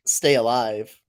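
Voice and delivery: ordinary delivery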